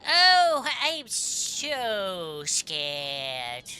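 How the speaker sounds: huge high "oh", then words dragged out slowly; mock fear. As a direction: drawl it in sarcastic pretend terror. Mocks the villain's threat in game.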